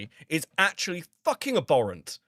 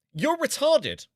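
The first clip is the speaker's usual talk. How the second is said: indignant; 12 semitones higher, 4 dB louder, slower than usual. Real, not acted. Insulting a commenter.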